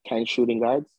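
ordinary talk, nothing marked

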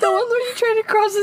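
words squeezed through laughter; laughing while talking. Real, not acted. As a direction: talk mid-laugh, high and strained.

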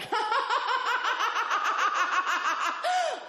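long, high, manic cackle; forced fake laugh. Lone stoned driver faking a good time, forced laugh. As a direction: laugh mechanically on and on. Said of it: "having a good time, they laugh".

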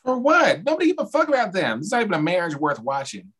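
high, rapid-fire disbelief; exasperated high-pitched protest; indignant objection. Real, not acted. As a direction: fire back fast, dismissing the whole idea. Heated objection to keeping Jen.

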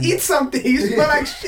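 high, loud retold yell; hammed-up angry yell; mock shout. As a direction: shout it at his face. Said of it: "He's like".

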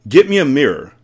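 urgent shouted demand; yelling. A narrator's reading. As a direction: yell it as the impatient father. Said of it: "the father yelled".

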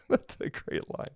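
soft breathy laugh through the words. Real, not acted. Teacher praising a viewer's joke, laughing softly. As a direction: chuckle while praising the line.

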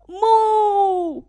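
high, loud mooing cow; 牛大声哞叫. Acted. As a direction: bellow it like a cow. Animals chase the farmer.

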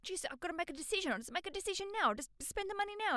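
high, rushed, pressured voice; stressed out. Acted. Bride acting out a panicked wedding purchase, stressed and high. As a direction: blurt it out in a high-pitched fluster. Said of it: "it can stress you out".

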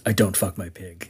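low, quiet and dead serious; quiet gravitas from Cage; low grave deadpan. Retold or imitated. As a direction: drop the voice down and make it solemn. Cage's grave reply after a pause.

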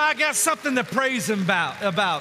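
loud, high fervent exhortation; preaching at full force. Real, not acted. Preacher urges congregation to come ready to praise, fervent. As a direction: urge them on with fire.

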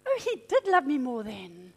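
put-on a wife whispering over her husband's old letters; mock wistful reading. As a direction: breathe it out like mooning over love letters. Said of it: "I can go through".

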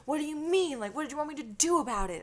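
retold yelling. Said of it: "He was yelling and screaming at me".

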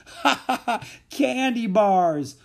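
high guffaw into a slow shout; loud, high hilarity; laughing shout. A narrator's reading. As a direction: burst out laughing, then holler it. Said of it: "the laughter beginning again".

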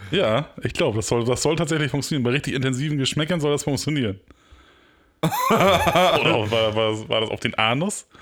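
ordinary talk

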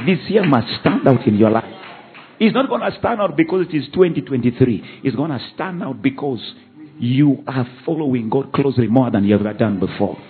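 loud, rising preacher's exhortation; preaching at full force. Acted. Pastor exhorting his congregation, loud preaching. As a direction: drive the message home to the church.

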